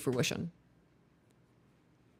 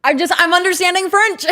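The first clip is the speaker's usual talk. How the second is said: high, loud excited squeal; 14 semitones higher, 8 dB louder than usual. Real, not acted. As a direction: squeal with delight. Excited laughing at understanding French.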